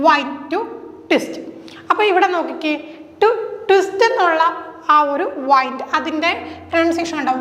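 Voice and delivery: ordinary talk, nothing marked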